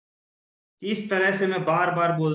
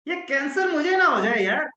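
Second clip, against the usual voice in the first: higher, casual and breezy; changed lighter tone; 7 semitones higher than usual. Shown as a demonstration. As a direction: toss it off lightly with a shrug. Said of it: "change कर दिया मैंने". (हल्के-फुल्के अंदाज़ में, ऊँची आवाज़ में)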